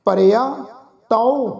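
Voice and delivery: lightly chanted verse, slowed down; slow recitation. Shown as a demonstration. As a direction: read the line out slowly as a model. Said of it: "ਅਸੀਂ ਉਚਾਰਨ ਕੀਤਾ ਹੈ".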